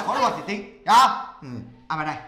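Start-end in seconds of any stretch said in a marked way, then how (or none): 1.9-2.2 low, hushed aside; confidential wheedling. Acted. Man asking his old acquaintance for money, confidential wheedling. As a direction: sidle up and ask softly for a favour. Sly request to conjure money.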